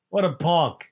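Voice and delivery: usual speaking voice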